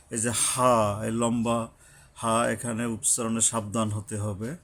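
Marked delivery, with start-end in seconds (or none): none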